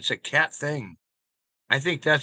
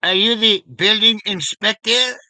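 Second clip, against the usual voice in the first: mock Clouseau question; 7 semitones higher, 8 dB louder than usual. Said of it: "I go".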